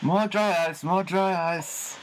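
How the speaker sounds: pampered rock star ordering roadies; diva demand. Pretend. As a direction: whine like a spoiled star.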